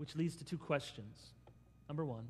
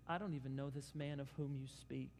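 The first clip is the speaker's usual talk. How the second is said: muttered denial; 3 semitones lower, 8 dB quieter than usual. Retold or imitated. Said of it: "He says".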